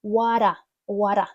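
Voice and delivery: "What a" run together as "wadda"; linked words. Shown as a demonstration. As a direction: flap the t in "What a", one word. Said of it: "Чуєте, як я вимовляю? Не what a".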